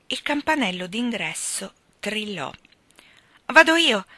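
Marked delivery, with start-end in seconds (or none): 3.5-4.0 quick eager offer, higher and louder. A narrator's reading. Fiona rushing to open the door for Mark, eager. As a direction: jump up to get the door. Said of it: "disse subito Fiona".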